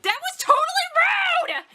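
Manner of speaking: put-on high squealing girl voice; girly character voice. Voicing a shrill character.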